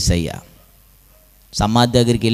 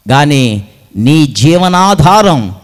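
normal talking voice

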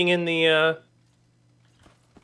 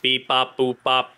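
beeping robot noises, then robot line; 5 dB louder, faster than usual. Pretend. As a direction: play a little toy robot. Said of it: "I am a robot".

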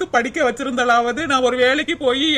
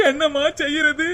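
ordinary talk, nothing marked

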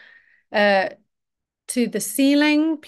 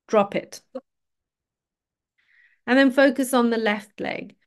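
usual speaking voice

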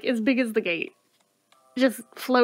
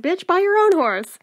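mock exasperation; 6 semitones higher, 3 dB louder than usual. Mock retort to game character.

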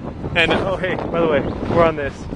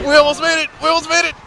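excited; 9 semitones higher, 4 dB louder, faster than usual. Real, not acted. Sled stops short.

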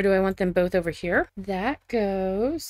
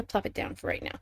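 breathy whisper to herself, much softer; barely voiced, just under the breath; whispered aside; 11 dB quieter than usual. Real, not acted. Placing a couch, whispering to herself.

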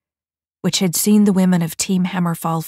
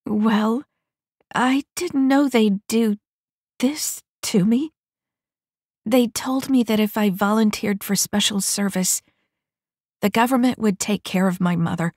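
sad pleading; 5 semitones higher than usual. Acted. Vi explains ruefully.

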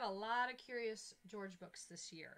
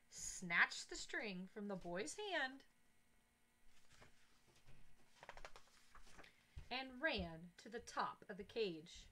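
put-on reading to children; 4 dB louder than usual. A narrator's reading. Teacher reads story aloud.

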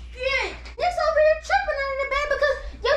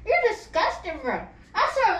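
ordinary talk

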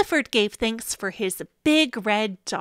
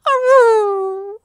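high, loud mournful aroo; sad dog howl; 9 semitones higher, 7 dB louder than usual. Acted. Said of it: "T-Bone howled".

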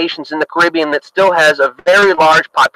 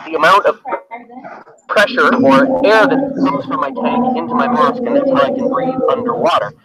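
breathing off a tank; 2 semitones higher than usual. Real, not acted. Diver explains the gear to viewers, breathing through a regulator. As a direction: voice inside a dive mask with regulator noise. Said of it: "you're gonna hear me breathing off of my tank".

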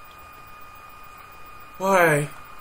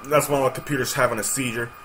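usual speaking voice

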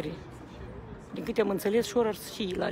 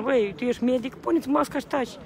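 higher, louder, indignant comeback; retold retort; 5 semitones higher, 4 dB louder than usual. Speaker answering someone who yelled about the mask, retold indignant retort. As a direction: retell a sharp telling-off at raised pitch. Retells snapping back at a shouter.

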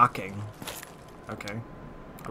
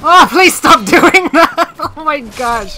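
scared yelp; 12 semitones higher, 11 dB louder, slower than usual. Real, not acted. Another jump scare, laughing scream.

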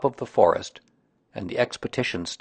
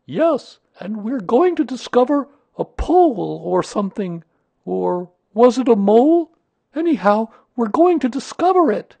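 very high, loud bear voice; high-pitched bear, excited and unsure of the words; eager, muddled bear; 14 semitones higher, 7 dB louder than usual. Acted.

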